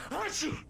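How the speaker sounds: sudden high sneeze. Acted. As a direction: sneeze in the middle of talking. [name] sneezing mid-complaint.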